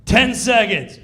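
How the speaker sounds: countdown shout. Retold or imitated. Stage manager shouting the countdown to the cast. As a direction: bark the time left.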